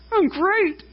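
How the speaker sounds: high, bright forced cheer hiding tears; fake cheerful. Tired parent answering a visitor's greeting, forced cheer. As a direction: cover near-tears with a falsely cheery answer. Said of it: "you're just trying to fake it".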